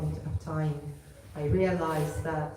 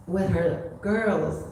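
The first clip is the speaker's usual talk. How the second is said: normal talking voice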